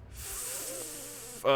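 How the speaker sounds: slow drawn-out ghostly whisper; eerie whisper. Acted. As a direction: breathe it out slow and haunting. Creepy whispered echo.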